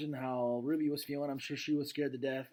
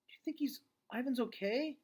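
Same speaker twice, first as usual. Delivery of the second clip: put-on much higher young daughter insisting; girl's voice; 11 semitones higher than usual. Julia urges her dad to look, high girl voice. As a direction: pitch up into a girl pleading.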